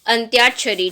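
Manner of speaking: अंत्याक्षरी as "ant-yaak-shari", falling at the end; correct Y glide. Shown as a demonstration. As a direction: model अंत्याक्षरी with a clear Y after T. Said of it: "जो शुद्ध शब्द है".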